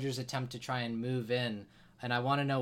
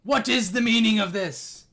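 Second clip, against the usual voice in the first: a much higher, much louder furious roar; dragon's yell; 10 semitones higher, 12 dB louder than usual. Acted. The dragon rises and yells at the intruders, loud and furious. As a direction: bellow it as the outraged dragon. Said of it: "rises and yells".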